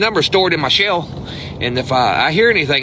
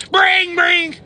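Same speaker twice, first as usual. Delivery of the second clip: phone ringing imitation. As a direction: chant it like a desperate ringing phone. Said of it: "This is impolite, but I gotta do it".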